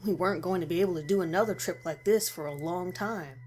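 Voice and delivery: plain reading voice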